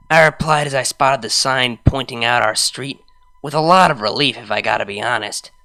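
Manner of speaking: ordinary delivery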